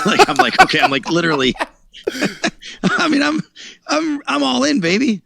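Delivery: high, laughing voice breaking into giggles; high-pitched laughter, all in; laughing excitedly. Real, not acted. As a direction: laugh through it, giddy at the madness. Laughing at his own all-in gamble.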